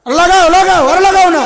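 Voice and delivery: shouted high, egging the crowd on; loud rallying cry to keep chanting; loud urging. Real, not acted. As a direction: cheer them on at full voice.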